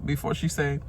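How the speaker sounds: ordinary talk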